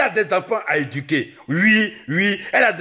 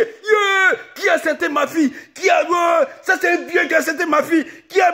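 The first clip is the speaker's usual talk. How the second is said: mock panicked mother shouting; mother crying out; 10 semitones higher than usual. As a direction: shriek out who did it to her daughter. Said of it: "Le temps qu'elle va crier".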